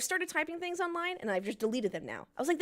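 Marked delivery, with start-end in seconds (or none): none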